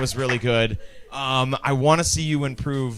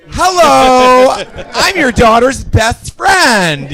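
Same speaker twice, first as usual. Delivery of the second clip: loud, high, drawn-out flamboyant entrance; ekstrawagancki przyjaciel; 10 semitones higher, 9 dB louder, much slower than usual. Acted. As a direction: burst through the door with a stretched, showy greeting. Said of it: "open that door and go".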